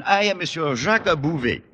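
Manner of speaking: put-on lightly French-accented English. Frenchman introducing himself, in a French accent.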